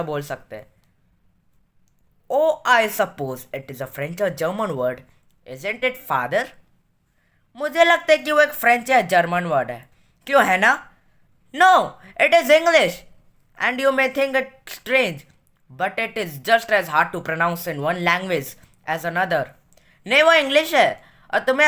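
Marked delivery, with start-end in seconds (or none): 2.3-6.4 very slow, deliberate read-aloud; measured classroom reading; story reading. A narrator's reading. Reads story aloud in English. 11.5-19.4 very slow, deliberate read-aloud; measured classroom reading; story reading. A narrator's reading. Reads story aloud in English.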